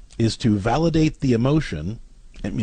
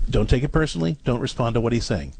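usual speaking voice

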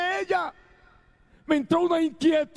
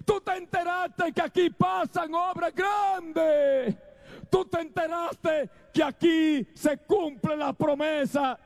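preaching at full force; 4 semitones higher than usual. Acted. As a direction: build the questions like a revival preacher. Preacher's rising rhetorical questions.

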